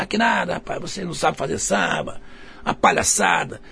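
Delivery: mock-indignant brush-off, softened; imitando o nervoso. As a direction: imitate an annoyed man dismissing you, without raising the volume. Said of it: "Ele tava nervoso". (imitação de bronca indignada, em voz baixa)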